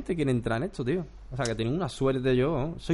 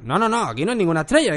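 stern retort; 6 semitones higher, 6 dB louder than usual. Retold or imitated. Tere's stern retort voiced.

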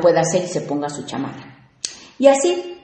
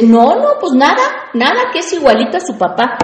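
defensive denial; 7 semitones higher, 6 dB louder than usual. Retold or imitated. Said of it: "Y uno dice".

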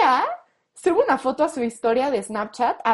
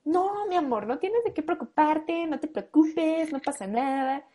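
higher, soft syrupy reassurance; mock sweet boyfriend; 5 semitones higher, 5 dB quieter, slower than usual. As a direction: imitate the smooth-talking boyfriend sweetly. Ex-boyfriend voiced reassuring her. (novio empalagoso imitado, más agudo y suave)

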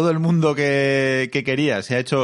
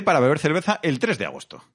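normal talking voice

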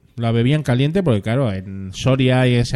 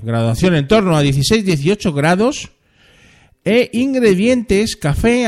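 higher, announcer-like reading of an encyclopedia entry; reading aloud; 7 semitones higher than usual. Acted. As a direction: read the entry out like a formal recitation. Reads Wikipedia entry aloud. (lectura en voz alta, tono de locutor)

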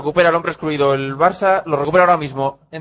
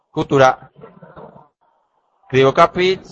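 ordinary talk, nothing marked

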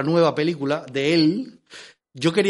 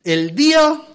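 high, loud, drawn-out build-up; ringmaster reveal; 8 semitones higher, 5 dB louder than usual. Pretend. Host revealing the day he celebrates, ringmaster reveal. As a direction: trumpet it with suspense before the reveal.

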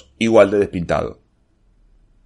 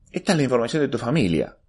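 soft, gentle grandmotherly voice; sweet serene grandmother; 8 dB quieter, faster than usual. A narrator's reading. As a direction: speak softly, like a calm grandma. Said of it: "una voz dulce, serena, como de abuela".

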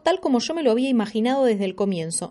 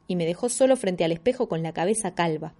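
ordinary delivery

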